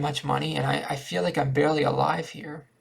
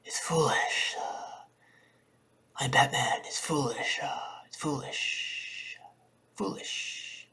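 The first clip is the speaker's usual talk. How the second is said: hushed mock Batman rasp; 4 dB quieter, slower than usual. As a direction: growl it softly like Batman in the shadows. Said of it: "As I say in my Batman voice".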